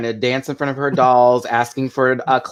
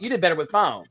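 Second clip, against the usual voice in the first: ordinary talk, nothing marked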